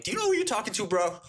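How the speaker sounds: high-pitched, affronted comeback; mock indignation. Bragging back at the stock-picking professor, mock indignant.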